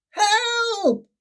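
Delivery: very high, thin cry for help. A narrator's reading. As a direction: call out desperately, lost at sea. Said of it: "cried Sorry again and again".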